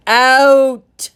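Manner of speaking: loud single-word bellow; roared command. Acted. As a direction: bark him out. Headmaster roars again.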